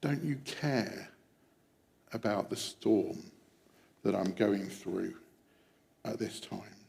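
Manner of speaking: slow, hushed confession of doubt; private plea. Acted. Preacher recalling own doubts to Jesus in storms, quiet private plea. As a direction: let it sink low and soft, slowly. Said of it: "I have said or thought".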